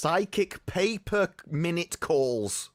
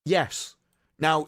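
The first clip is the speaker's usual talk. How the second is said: normal talking voice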